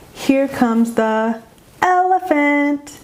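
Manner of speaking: "elephant" set apart after a silence, stretched out and high; slow lead-in, then a melodic, high "elephant"; acoustic highlighting. Shown as a demonstration. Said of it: "a little bit of silence and space before I said".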